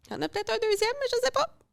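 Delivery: strained high voice before a sneeze; squeaky, fighting off a sneeze; sneeze coming. Real, not acted. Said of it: "Je vais éternuer".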